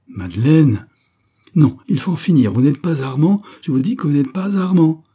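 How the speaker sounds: loud, rising denial; insistent outburst. A narrator's reading. Madeleine loudly refuses the count who claims to be Armand. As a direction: cut him off, refusing to believe.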